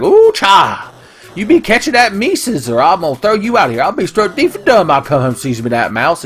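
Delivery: high-pitched scolding cartoon housekeeper; Big Mammy. Housekeeper threatening to throw the cat out, high scolding voice. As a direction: scold in an exaggerated cartoon dialect. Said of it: "If Big Mammy's in it, she's like".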